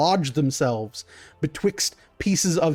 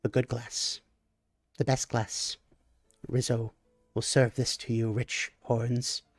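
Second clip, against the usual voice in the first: lower, much softer reverent murmur; reverent hush; 4 semitones lower, 8 dB quieter than usual. Acted. Wizzo presenting the chalice to the rich customer, hushed and low. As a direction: present it like a holy relic, softly and low. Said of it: "looks to you and goes".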